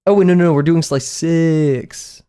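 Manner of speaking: startled self-correction; sudden realization. Real, not acted. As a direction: catch yourself mid-sentence, alarmed. Realizes a mistake mid-stream.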